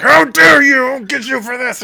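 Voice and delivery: furious high shout of a villain; sputtering foiled villain. Acted. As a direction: roar it out, outraged and caught. GM voices dragonborn waking.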